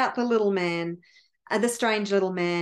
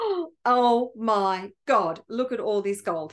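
overjoyed king gasping in amazement; gasp, then draw the words out in delight; beside himself. Acted. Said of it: "He is absolutely beside himself".

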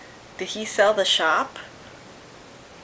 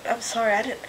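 ordinary delivery

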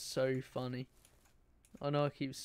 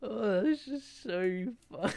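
laughing; 6 semitones higher than usual. Real, not acted. Laughing at the crash.

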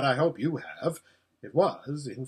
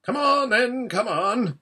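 high, loud dwarf chivvying; brisk urging; 9 semitones higher, 6 dB louder, slower than usual. Acted. Dwarf helping faint Shasta, urging him on. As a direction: hurry the boy along.

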